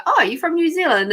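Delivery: mock exclamation of recognition; surprised listener. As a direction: pipe up as someone spotting the accent. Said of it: "and people go".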